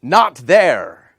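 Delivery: "Not there" called out loud, falling on "Not"; magic word. Shown as a demonstration. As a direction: announce "Not there" like a spell. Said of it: "You say".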